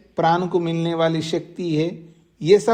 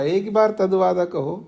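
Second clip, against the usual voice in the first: usual speaking voice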